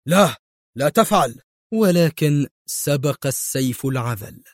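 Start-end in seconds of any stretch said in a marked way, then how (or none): 0.1-1.4 loud warning cry; urgent, raised-voice warning; shouting. A narrator's reading. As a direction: cry out to stop someone. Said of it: "فصاح به".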